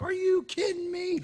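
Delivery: high, slow, drawn-out are you kidding me; hammed-up disbelieving cop voice; incredulous. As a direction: squeak it up in mock disbelief.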